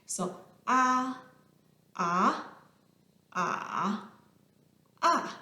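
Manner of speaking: "ah" flat, rising, dipping, falling; four tones in sequence. Shown as a demonstration. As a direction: run the four tones on "ah" one by one. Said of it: "four tones in Mandarin".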